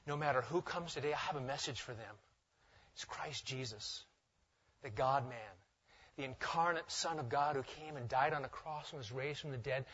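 very soft, low and earnest; hushed resolve. Acted. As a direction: speak softly, as if resolving it to yourself. Voices teacher's resolve said to oneself.

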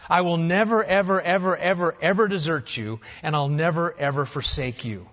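each ever pressed a little harder, stacked up; emphatic promise. Retold or imitated. Preacher quoting God's emphatic promise, stressed never and ever. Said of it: "he himself emphatically said".

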